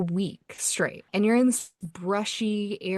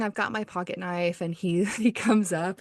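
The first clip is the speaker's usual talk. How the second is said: usual speaking voice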